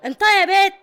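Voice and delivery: loud high threatening demand; menacing shout. Acted. Boss confronts inmate she suspects, loud menacing shout. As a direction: bark the question at her.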